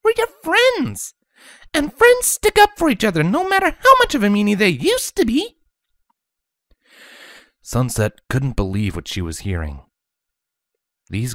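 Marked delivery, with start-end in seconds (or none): 0.0-5.6 put-on very high, chirpy girl voice; bubbly Pinkie. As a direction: bounce the question back. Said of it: "Pinkie Pie asked".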